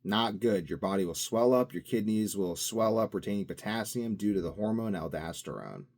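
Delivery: ordinary delivery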